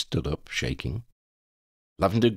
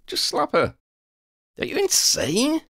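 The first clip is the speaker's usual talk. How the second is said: character voice; 3 dB louder than usual. Acted. Higher voiced character exchange.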